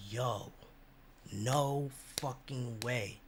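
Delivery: stunned disbelief. Retold or imitated. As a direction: let it sink in, hushed. Stunned by being allowed.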